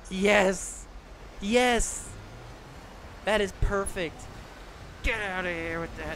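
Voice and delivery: real jubilant shouts; elated yelling. Real, not acted. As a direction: cheer like you just won. Celebrating the kill.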